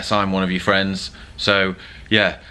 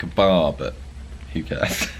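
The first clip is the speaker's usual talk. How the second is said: normal talking voice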